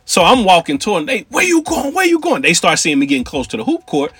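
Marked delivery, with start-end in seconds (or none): 1.3-2.4 much higher mock holler, repeated; yelling dope fiends. Dope fiends yelling after Mike, high mock holler. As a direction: call after him, high and pushy.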